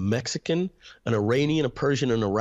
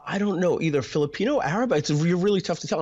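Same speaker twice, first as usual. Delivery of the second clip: lightly imitated guesser, pitched higher; puzzled guesser; 6 semitones higher than usual. People guessing his ethnicity when he asks, puzzled higher voice. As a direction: mimic someone unsure, guessing in a higher voice.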